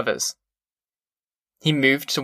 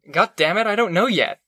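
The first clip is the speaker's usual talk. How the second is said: higher-pitched, exasperated retort; fed-up comeback, pitched up; 6 semitones higher than usual. Acted. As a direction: protest in rising irritation, pitch lifting. Contractor snapping back in a quarrel.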